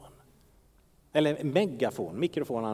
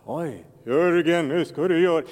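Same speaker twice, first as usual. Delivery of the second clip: louder, theatrical divine command; mock voice of God; 7 dB louder than usual. Pastor mocking the idea of God dictating his tasks, theatrical. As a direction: play God giving orders, hammed-up. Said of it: "Så här".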